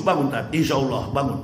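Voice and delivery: soft, low, coaxing wake-up; tender angel voice. Acted. Angel gently waking the preacher himself, tenderly soft. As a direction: gently rouse a friend from sleep. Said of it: "lembut sekali".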